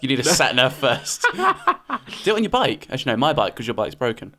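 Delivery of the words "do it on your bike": laughing tease. Real, not acted. Teasing with a laugh.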